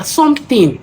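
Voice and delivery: normal talking voice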